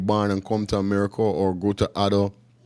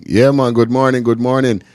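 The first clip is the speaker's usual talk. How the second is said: loud, high hyped-up hello; loud excited shout-out; hype greeting; 4 semitones higher, 7 dB louder than usual. Real, not acted. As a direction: pump up the morning crowd.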